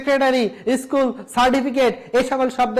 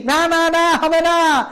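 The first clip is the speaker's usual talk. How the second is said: mock alarmed no; 6 semitones higher, 4 dB louder, much slower than usual. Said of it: "teacher correcting a wrong pronunciation".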